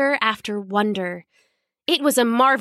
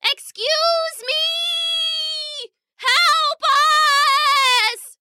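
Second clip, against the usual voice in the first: very high, drawn-out kitten yowl; high, slow, wailing kitten plea; yowling kitten cry; much slower than usual. Acted. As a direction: stretch it out like a tiny cat crying for help. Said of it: "she yowled for all she was worth".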